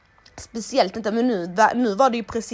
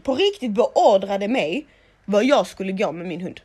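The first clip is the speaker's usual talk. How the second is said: ordinary delivery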